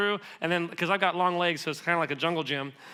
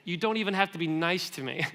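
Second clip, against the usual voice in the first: normal talking voice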